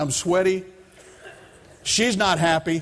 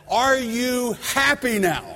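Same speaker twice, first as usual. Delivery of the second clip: high, slow, exasperated yelling at God; yelled complaint; 8 semitones higher, slower than usual. Retold or imitated. Father yelling at God in his front yard, high and exasperated. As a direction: fling the question up to heaven, half laughing. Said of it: "yelling out in the middle of my front yard as loud as I can".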